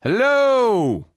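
loud, high, long-held holler; calling for an echo. Acted. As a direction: call out into empty space to test the echo. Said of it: "I wonder if this place has an echo".

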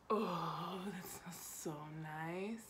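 soft whisper. Real, not acted. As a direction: breathe it quietly. Smelling the lip scrub.

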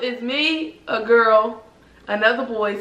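usual speaking voice

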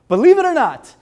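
big excited pitch jump. Real, not acted. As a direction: sell it with a sudden high burst. Hyping the product.

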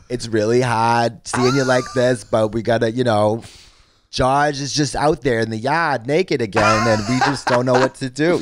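slow mock Boston accent; a hammed-up Boston family pleading; imitating a Boston family at an intervention. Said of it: "that would be the same thing with Boston".

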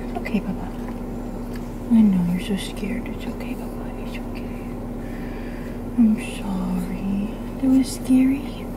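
hushed, tender whispering; soothing whisper. Real, not acted. Owner reassuring her scared dog, soothing whisper. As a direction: whisper comfort to a frightened pet.

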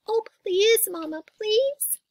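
high, drawn-out begging; pleading cry. A narrator's reading. Sister begging Mama to look, high pleading cry. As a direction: beg like a little sister. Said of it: "cried Sister".

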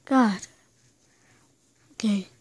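ordinary talk

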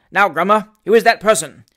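loud, stern magistrate; stern demand. Acted. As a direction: demand it like a pompous judge. Magistrate interrogating the prisoners.

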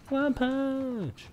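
One punch yelled high and sharp; dramatic high callout. Pretend. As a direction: shout it like an anime hero. Dramatic anime-style callout.

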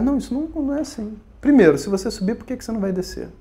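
ordinary talk, nothing marked

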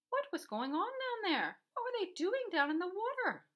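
high, loud, stretched questions; anxious storybook exclamation; alarmed wondering. A narrator's reading. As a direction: ask with mounting alarm. Boy wonders anxiously about vanished pair.

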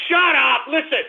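high-pitched irritated outburst; angry interruption. Real, not acted. Host cuts off listeners mocking his coins, angrily. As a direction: cut them off in a sudden high yell. Host snaps at jokey coin name.

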